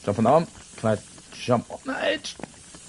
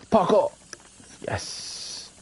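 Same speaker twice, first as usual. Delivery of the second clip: usual speaking voice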